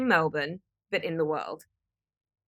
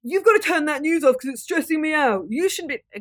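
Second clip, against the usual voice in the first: hammed-up scolding, higher and louder; mock exasperated telling-off, raised and loud; mock nagging demand; 8 semitones higher, 6 dB louder than usual. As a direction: play the pushy nag you shouldn't be. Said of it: "this isn't a".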